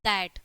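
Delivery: "that" with TH as Hindi द; TH pronunciation demo. Shown as a demonstration. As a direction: model "that" for learners, TH voiced like द. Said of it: "या तो हिंदी का द हो जाएगा".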